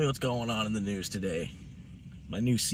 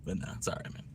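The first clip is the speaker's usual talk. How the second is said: whispered; 4 semitones lower, 10 dB quieter, faster than usual. Real, not acted. Calms down after joking.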